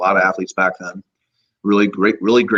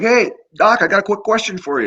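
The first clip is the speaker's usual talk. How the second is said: breezy greeting; 11 semitones higher than usual. Retold or imitated. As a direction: call out cheerfully to the old doctor. Casual greeting to the old doctor.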